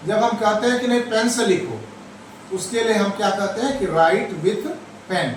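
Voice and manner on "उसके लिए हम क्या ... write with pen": ordinary delivery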